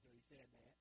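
normal talking voice

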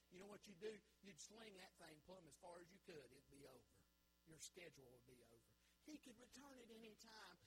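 ordinary talk, nothing marked

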